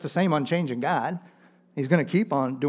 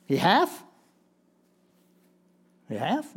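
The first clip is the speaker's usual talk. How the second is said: "He hath" pitched up, rising at the end; incredulous questioning; 6 semitones higher than usual. Retold or imitated. Questions the verse's past tense.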